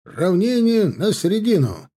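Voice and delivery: slow, high parade-ground shout; shouting drill sergeant. A narrator's reading. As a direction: bellow the commands across the field. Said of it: "разнёсся крик высокого мушкетёрского майора".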